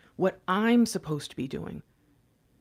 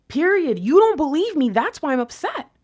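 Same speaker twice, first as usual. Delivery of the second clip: louder, upset and insistent; upset insistence; 6 semitones higher, 7 dB louder than usual. Acted. Divine Feminine telling partner they're upset, louder and insistent. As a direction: hammer it home like you're hurt.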